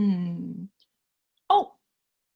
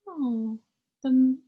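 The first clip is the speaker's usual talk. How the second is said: ordinary delivery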